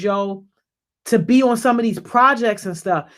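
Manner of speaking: ordinary delivery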